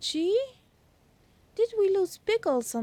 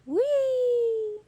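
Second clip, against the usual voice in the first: excited whee; 9 semitones higher, 5 dB louder than usual. Real, not acted. Celebrating successful landing.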